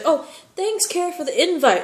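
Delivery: high, bright mock thanks; fake-sweet thanks. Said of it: "she's like".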